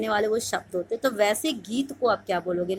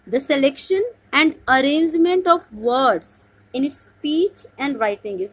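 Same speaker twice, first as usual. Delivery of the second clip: slow dictation reading; 3 semitones higher, 4 dB louder, much slower than usual. Shown as a demonstration. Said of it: "teacher reads out exam question".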